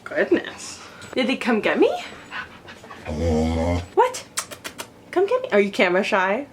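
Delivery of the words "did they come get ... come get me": pet talk. Real, not acted. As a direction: coo at the puppy, pitch up. Talking to her dog.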